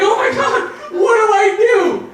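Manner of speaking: high, loud mock panic; panicked high-pitched yell, played up; freaking out. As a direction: shout it in a comic panic. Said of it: "I'm freaking out".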